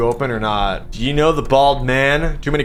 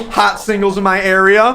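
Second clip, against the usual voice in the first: hot singles in my area pitched up like a spam ad; cheesy ad pitch; 8 semitones higher, 4 dB louder than usual. Acted. Reading a phone roulette ad as a pitched-up cheesy promo. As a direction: sell it like a late-night hotline ad. Ad voice versus flat comment.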